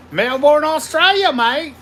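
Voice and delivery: loud, high mock Aussie greeting; hammed-up Australian shout-out; big Aussie "Melbourne, Australia, mate"; Aussie accent.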